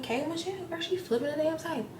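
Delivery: high mock gossip to a friend. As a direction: tattle to a friend in a high squeal. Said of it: "She like".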